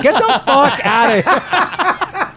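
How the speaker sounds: high, slow, laughing disbelief; laughing refusal. Retold or imitated. Telling the scammer to get lost, laughing high and slow.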